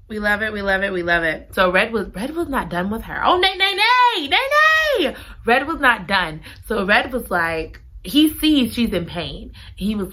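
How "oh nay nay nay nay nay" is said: high, singsong string of nays; mock protest. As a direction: playfully crow the denial way up high. Playful exaggerated denial.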